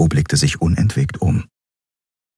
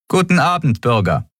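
brief officious guard greeting; a hint of a clipped officer; guard curtly addressing travellers; 9 semitones higher than usual. A narrator's reading. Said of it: "sagte sie knapp".

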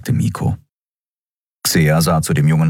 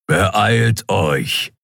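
ordinary delivery

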